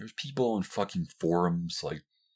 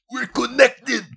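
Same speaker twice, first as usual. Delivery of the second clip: much higher, louder squealed exclamation; hammed-up fan squeal; mocking gushing fan; 12 dB louder, slower than usual. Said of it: "they're like".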